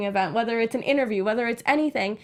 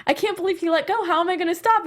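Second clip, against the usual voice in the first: a touch of raised-pitch mock alarm; retold freak-out; 6 semitones higher than usual. Remembering dad letting go of the bike, mild mock alarm. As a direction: replay the kid's alarm lightly, a bit higher. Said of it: "I literally remember freaking out".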